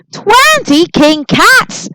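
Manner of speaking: very high, loud, slow screech. A narrator's reading. Charlie learns ten king kids are coming, high loud screech. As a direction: stretch out a shocked screech. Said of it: "screeched Charlie McBean".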